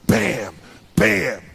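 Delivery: loud punchy bam bam; impact sound words. Retold or imitated. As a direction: hit each bam hard like a blow. Boys tackling him.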